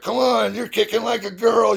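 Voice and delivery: high-pitched mock yell, no real volume; imitated coach's yell. Coach yelling at the boys on the team, high mock yell. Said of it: "would yell stuff at the boys".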